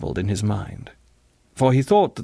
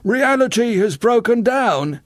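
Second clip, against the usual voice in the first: loud, high, drawn-out proclamation; high, slow tipsy declaration; happy drunk boy; 12 semitones higher, 5 dB louder than usual. A narrator's reading. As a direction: announce it slowly, high and loud. Fat drunk boy proclaims happily.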